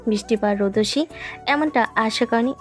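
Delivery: ordinary delivery, nothing marked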